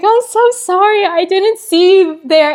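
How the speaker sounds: high, teary, panicky apology; flustered apology. Retold or imitated. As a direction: blurt the sorry out high, close to tears. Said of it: "I was like".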